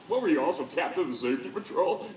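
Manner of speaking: retold snide roommate; mocking jab. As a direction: sneer at the kid's resume. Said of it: "he goes".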